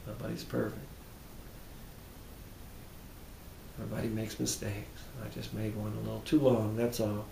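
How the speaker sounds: murmured self-forgiveness; soft self-talk. Shown as a demonstration. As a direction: soothe yourself quietly, almost under the breath. Recites self-forgiveness affirmations.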